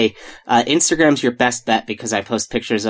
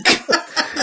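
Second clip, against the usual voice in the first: breathy laugh; 13 semitones higher, 3 dB quieter than usual. Real, not acted. Laughing at joke.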